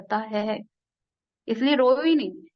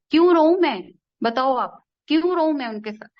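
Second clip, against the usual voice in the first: louder and higher, rising in protest; defiant outburst; 4 semitones higher, 6 dB louder than usual. Real, not acted. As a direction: fling the question back, why would I cry. Defiant rhetorical question to the audience. (ज़ोर से, विरोध में सवाल)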